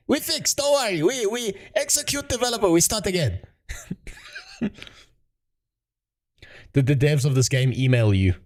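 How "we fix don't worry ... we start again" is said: high, thin put-on Chinese accent in broken English; mock Chinese accent.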